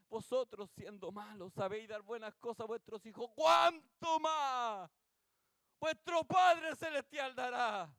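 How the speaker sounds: scripture rising to a loud, high climax; building preaching. Real, not acted. Repite el versículo como remate. (versículo que crece hasta proclamarse a toda voz)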